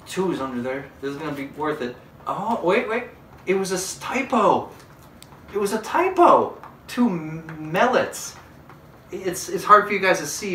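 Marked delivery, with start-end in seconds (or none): 2.2-7.7 high, loud gasping discovery; shocked. Acted. 7.7-8.4 mellets with an e sound, not mallets; mispronounced mallets. Shown as a demonstration. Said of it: "not how you spell mallet".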